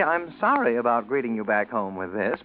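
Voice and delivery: normal talking voice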